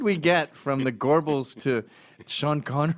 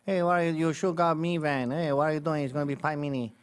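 mock Puerto Rican accent; faster than usual. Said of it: "Puerto Ricans do this when they speak English".

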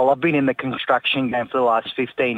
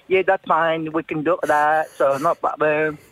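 put-on picked-up Chinese accent; 3 semitones higher than usual. Builder accidentally copying his Chinese worker while replying, Chinese accent. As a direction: slip into the other man's Chinese accent. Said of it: "I picked up his accent".

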